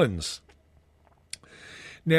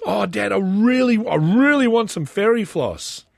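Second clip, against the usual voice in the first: put-on high, louder child's whine; pleading kid; 6 semitones higher, 5 dB louder than usual. Dad imitating his son begging for fairy floss. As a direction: beg like a little boy. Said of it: "he goes".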